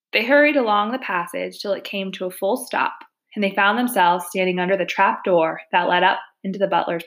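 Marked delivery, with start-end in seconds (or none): none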